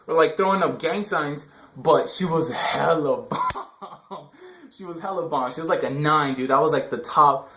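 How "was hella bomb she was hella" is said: laughing. Real, not acted. Laughing about the girlfriend.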